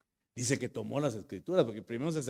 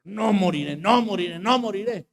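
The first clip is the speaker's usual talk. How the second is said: higher, louder, building each time; shouting prayer; 8 semitones higher, 10 dB louder than usual. Retold or imitated. Man in hospital fighting for breath, shouting his faith. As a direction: hammer the promise again and again. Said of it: "Y comencé a gritar".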